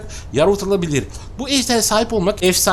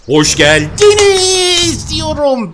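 high, bright-pitched channel welcome; vlog intro greeting; 9 semitones higher than usual. Acted. YouTuber welcomes subscribers to the channel, lifted showman greeting.

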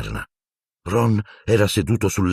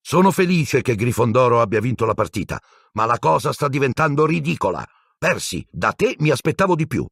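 stern anger; 7 semitones higher than usual. Acted. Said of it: "guardò intorno furente".

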